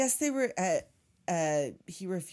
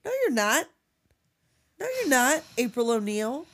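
higher, louder sassy refusal; mock scolding; 4 semitones higher, 5 dB louder than usual. Real, not acted. Talking back to O'Neill, high sassy refusal. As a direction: shut him down with sass.

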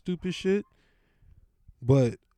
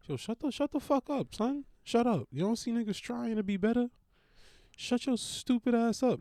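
much higher, fast mock scolding; mock yell at dogs; 7 semitones higher, faster than usual. Narrator mock-yelling at barking dogs to shut up. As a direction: snap at the yapping dogs, hammed-up.

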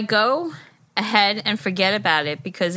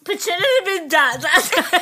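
giggling; 7 semitones higher than usual. Real, not acted. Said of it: "Let's try that again".

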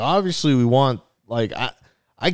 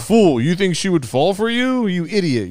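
hammed-up cartoon villain taunting, higher; mock villain; 7 semitones higher than usual. Mocking a negative fan at the bar, hammed-up villain voice. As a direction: sneer it like a gloating anime boss. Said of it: "This isn't even my final form".

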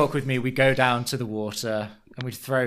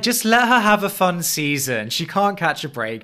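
louder, pleading outburst; exasperated plea; 3 semitones higher, 4 dB louder than usual. Retold or imitated. A fan pleading on Shannon's behalf, loud and exasperated. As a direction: beg them to let her be fun. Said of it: "you just wanna be like".

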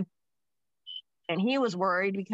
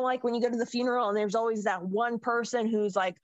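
usual speaking voice

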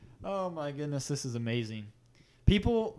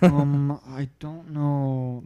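Lorne Michaels impression; 3 dB louder, much slower than usual. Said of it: "That's my Lorne Michaels impression".

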